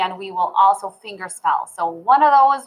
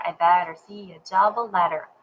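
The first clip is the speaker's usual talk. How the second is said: ordinary talk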